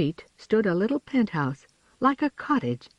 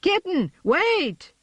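high urgent call; 13 semitones higher, slower than usual. A narrator's reading. Siblings chase the flying kitten, calling high.

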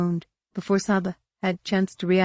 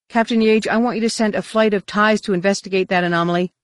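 louder, higher, official order; formal command; 5 semitones higher, 5 dB louder than usual. A narrator's reading. Luke to Captain Yage by comlink, formal command. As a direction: issue orders crisply over a comlink. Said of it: "the more formal tone in Luke's voice".

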